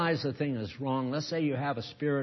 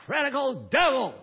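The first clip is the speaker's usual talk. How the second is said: slow, loud, high command to the devil; booming exorcism command; commanding rebuke; 13 semitones higher, 6 dB louder, slower than usual. Acted. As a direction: cast it out with full force. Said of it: "You say".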